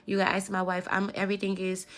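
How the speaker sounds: ordinary delivery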